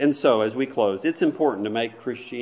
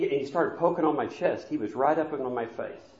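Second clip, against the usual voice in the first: normal talking voice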